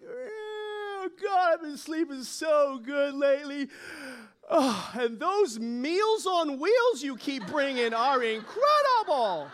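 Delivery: high, drawn-out, gushing cat voice; contented kitty savoring it, slow and loud by the end; blissed-out cat. Acted. Contented cat raves to God.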